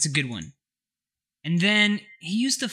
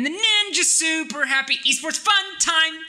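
announcer voice; 13 semitones higher, 4 dB louder than usual. Acted. Announcing segment name.

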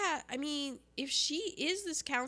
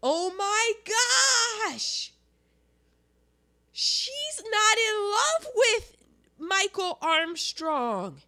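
1 excited realization; 11 semitones higher, 5 dB louder, slower than usual. Real, not acted. Sudden realization about the Countess.

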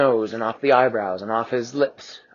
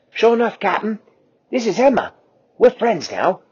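high, bright excitement; cheerful; 10 semitones higher, 5 dB louder than usual. Acted. Quasi cheerfully introducing Emma to the captain. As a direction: proudly introduce a friend.

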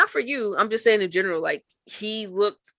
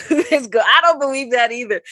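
laughing; 6 semitones higher, 7 dB louder than usual. Real, not acted. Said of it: "I can't even say that with a straight face".

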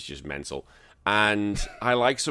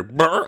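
mock heckling noise; 7 semitones higher, 7 dB louder than usual. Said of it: "one of them just go".

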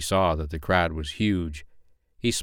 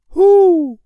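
loud, very high hoot; owl hoot; 12 dB louder than usual. A narrator's reading. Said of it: "said an owl".

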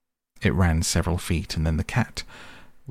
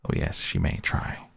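lower, softer, dismissive repeat; unimpressed repeat; 9 semitones lower, 3 dB quieter than usual. Acted. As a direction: let it drop, low and unbothered.

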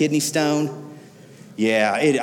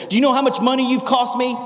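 higher, indignant demand; a touch of furious boss; angry executive; 8 semitones higher than usual. Retold or imitated. Executive to boy who broke his car, mock angry demand.

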